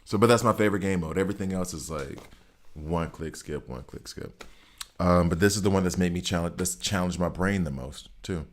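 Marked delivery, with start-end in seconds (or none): 3.7-4.4 breathy whisper, much softer; whispered aside. Real, not acted. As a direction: drop to a whisper. Repeating a phrase, second time whispered.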